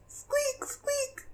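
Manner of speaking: very high squeak fading away; tiny mouse squeak, dying off softly. Acted. Animals answer in the fog.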